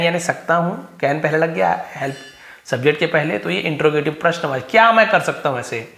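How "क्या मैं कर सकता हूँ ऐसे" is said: usual speaking voice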